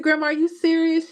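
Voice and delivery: can't believe grandma likes it, high and stunned; high astonished question. Retold or imitated. Granddaughter asking grandma in shock, high pitched. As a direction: voice leaps up in disbelief. Grandma praises her cake.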